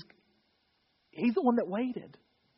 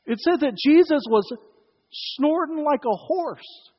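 emphatic horse snorting; 8 semitones higher, 8 dB louder than usual. Shown as a demonstration. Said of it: "horse snorting".

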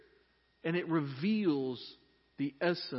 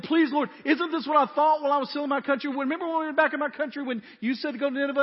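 louder, higher, pleading complaint at God; whiny angry prayer; 8 semitones higher, 6 dB louder, faster than usual. Retold or imitated. Jonah complaining to God, louder and higher. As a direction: Jonah griping loudly to the Lord. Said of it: "He prayed to the Lord".

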